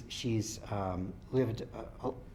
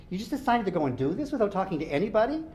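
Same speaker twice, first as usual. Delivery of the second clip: angry confronting; 8 semitones higher, 5 dB louder, faster than usual. Acted. Dylan confronts brother.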